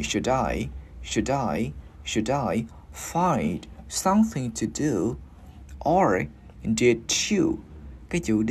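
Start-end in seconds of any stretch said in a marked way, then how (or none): 0.0-2.6 SHOULD I repeated as "shu-dai", D opening the second syllable; linked D. Shown as a demonstration. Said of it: "Và mình gắn với chữ I". 3.1-7.5 slow, phrase by phrase for learners; slow demonstration. Shown as a demonstration. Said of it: "mình cứ đi từ từ".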